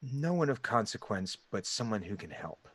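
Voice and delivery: very soft mysterious stranger; deep gravelly voice. Acted. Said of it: "it's in this deep, gravelly voice".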